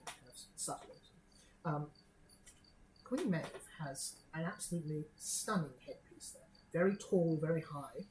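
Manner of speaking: normal talking voice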